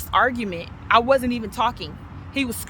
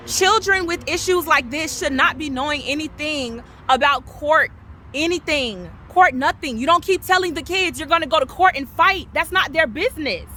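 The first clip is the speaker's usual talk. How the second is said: real heated yelling, high pitched; raised voice; 4 semitones higher than usual. Real, not acted. Mother defending her children from court talk, yelling. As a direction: get worked up and raise your voice. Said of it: "I'm sorry I'm yelling".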